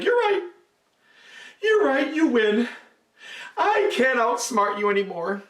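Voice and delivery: high, then slow mock surrender; high monkey giving in. A narrator's reading. Monkey tricking the crocodile, high mock surrender. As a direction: fake defeat, sly and high.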